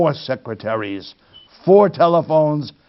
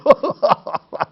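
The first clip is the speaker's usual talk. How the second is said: a big scornful laugh, pitched up; loud mocking laugh; 5 semitones higher than usual. Foreman laughs at the bear's excuse, loud mocking laugh. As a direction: laugh the bear off as a fool. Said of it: "The foreman laughed very loud".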